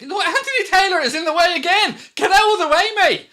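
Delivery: high, loud match-day outburst; exasperated shout. Real, not acted. Fan at referee Anthony Taylor in the way, exasperated high shout. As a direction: yell it at someone blocking play. Annoyed at referee blocking play.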